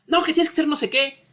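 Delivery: lightly hammed-up bossy boss; bossy boss quote. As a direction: imitate a boss giving orders. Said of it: "Me habla autoritariamente mi jefe hipotético".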